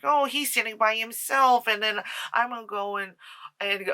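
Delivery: put-on a touch of a giddy young woman; mock Shelley. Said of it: "and it's like".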